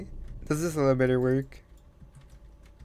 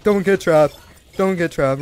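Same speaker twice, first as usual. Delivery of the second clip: louder, higher urgent repeating; being chased; 4 semitones higher, 8 dB louder than usual. Real, not acted. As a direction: urge yourself on in panic.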